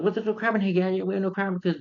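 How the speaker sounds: high-pitched imitation of an incredulous character; incredulous retort. Gadget Mobile answers Gadget about crime, mock incredulous and high.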